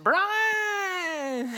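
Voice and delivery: high, drawn-out East Texas holler; East Texas accent. Retold or imitated. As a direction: call the name long and high in East Texas twang. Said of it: "he's got this real East Texas accent".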